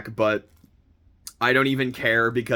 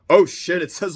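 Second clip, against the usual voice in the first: surprised; 6 semitones higher, 5 dB louder than usual. Real, not acted. Spots actor name on case.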